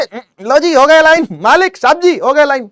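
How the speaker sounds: bow and obey the master at once; eager obedient servant. Acted. A keen material answering its master as an eager servant. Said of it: "कोई कोई material होगा".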